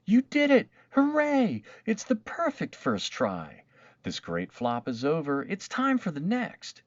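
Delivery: put-on a thrilled old aunt whooping with joy; joyful cheer. A narrator's reading. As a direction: cheer her on through happy tears. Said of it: "started to cry".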